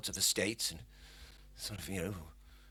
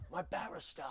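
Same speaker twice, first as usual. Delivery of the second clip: offhand mutter; 4 semitones higher, 13 dB quieter than usual. Retold or imitated. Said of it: "He goes".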